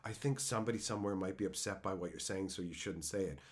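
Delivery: soft, low, hurried caution; timid objector. Retold or imitated. Internet scold warning someone might be upset, timid. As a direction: mimic a cautious internet objector, hushed and quick. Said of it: "people running around on the internet going".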